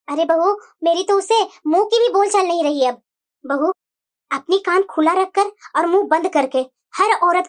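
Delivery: low, slow elder woman voice; low, slow mother-in-law giving an order; stern mother-in-law. Acted. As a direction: send the bahu off firmly as the elder. Mother-in-law advises bahu at length.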